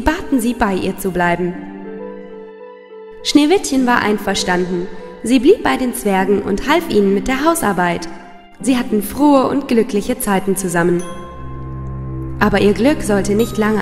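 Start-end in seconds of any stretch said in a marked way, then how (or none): none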